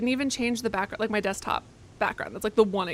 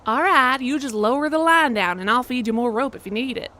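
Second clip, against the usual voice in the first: terrible Southern accent; 2 semitones higher, 5 dB louder than usual. Shown as a demonstration. Player trying accents for the game character, terrible Southern. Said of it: "terrible Scottish or terrible Southern".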